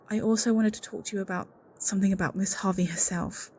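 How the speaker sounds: usual speaking voice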